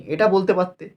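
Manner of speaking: usual speaking voice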